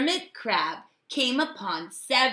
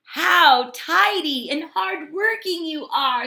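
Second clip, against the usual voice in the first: raised, louder praise; admiring Hermit Crab; 6 semitones higher, 6 dB louder than usual. A narrator's reading. Crab praising the snails, high and louder. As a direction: cheer the busy snails. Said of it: "said Hermit Crab".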